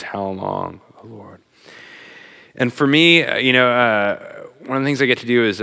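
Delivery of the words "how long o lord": quiet lament. Retold or imitated. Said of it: "Israel's cry is".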